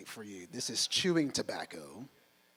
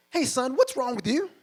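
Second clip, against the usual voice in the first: gruff coach; 10 semitones higher, 10 dB louder than usual. Retold or imitated. Coach's first question on phone.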